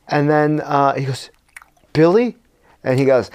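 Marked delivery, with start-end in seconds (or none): 1.9-2.4 higher, questioning call; tentative question. Retold or imitated. Tony facing a cat that may be his friend, questioning. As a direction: ask the name as if unsure. Hero unsure cat is Billy.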